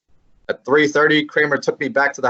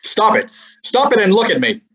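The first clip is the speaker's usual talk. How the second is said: higher and louder, sharp order to snap her out of it; firm command; 8 semitones higher, 4 dB louder than usual. Acted. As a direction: cut through her hysteria. Snapping her out of hysteria.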